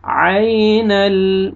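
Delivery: "आइनल" slow, first syllable stretched; lengthened glide in "आइनल"; drawn-out recitation. Shown as a demonstration. Teacher models drawn-out word.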